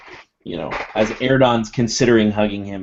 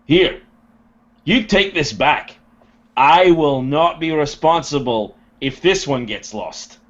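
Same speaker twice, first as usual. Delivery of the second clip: higher, louder gruff voice; Durgan handing it back; 6 semitones higher, 4 dB louder than usual. Acted. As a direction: hand it over firmly. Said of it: "he'd go".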